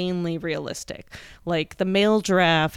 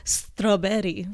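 put-on slow, drawn-out Italian-accented "Strawberry"; slower than usual. Mother imitating her daughter's comeback, "Strawberry" in an Italian accent. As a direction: mimic a child saying "Strawberry" with an Italian accent. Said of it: "She said it with an Italian accent".

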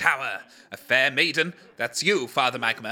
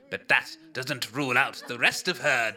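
normal talking voice

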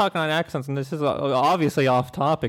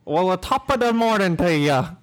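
British attempt that slides into Irish; badly faked Irish lilt; accidental Irish accent; 3 semitones higher than usual. Shown as a demonstration. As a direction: aim for British, land on stage Irish. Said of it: "that's Irish".

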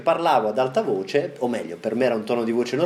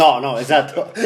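laughing; 2 semitones higher, 4 dB louder than usual. Real, not acted. Laughing agreement about mothers.

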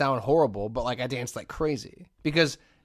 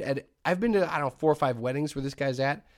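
ordinary delivery

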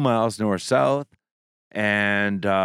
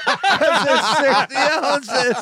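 high and slow through laughter; laughing; 13 semitones higher, slower than usual. Real, not acted. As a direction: laugh the words out.

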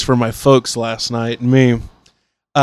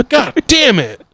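mock cook; 10 semitones higher, slower than usual. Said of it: "imitating annoyed cook".

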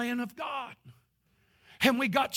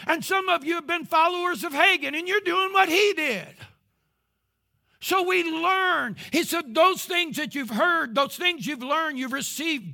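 building preaching; 6 semitones higher, 5 dB louder than usual. Acted.

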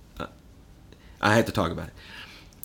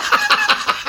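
sudden high laugh breaking into the rant; a sharp, high cackle; burst of laughter; 7 dB louder than usual. Real, not acted. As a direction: laugh out loud mid-sentence.

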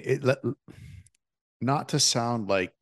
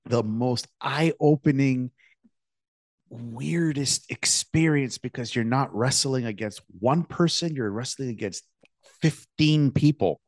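amazed; 5 semitones higher than usual. Real, not acted. Amazed retelling of class brawl.

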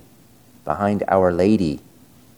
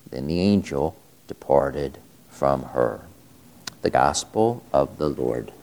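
lower, solemn liturgical reading; Gospel reading; 3 semitones lower than usual. Acted. Priest reads the Gospel aloud.

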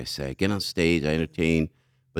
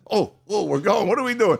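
higher-pitched startled questioning; retold alarm; 8 semitones higher than usual. Riders as the float starts moving, mock alarm. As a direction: act out being caught off guard. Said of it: "then it starts like this".

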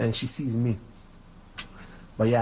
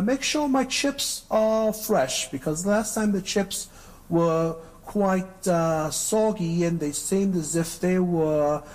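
put-on slow, high British accent; British accent, higher and deliberately slow; 7 semitones higher, slower than usual. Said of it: "let me do my, my British accent".

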